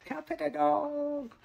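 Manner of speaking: put-on high squeaky little-kid voice; high childlike meme imitation. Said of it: "he's saying".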